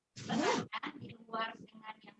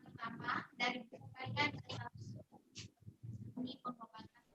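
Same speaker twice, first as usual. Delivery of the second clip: faint voice; 10 dB quieter than usual. Real, not acted. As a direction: voice too quiet, phone held away. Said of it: "suaranya kecil".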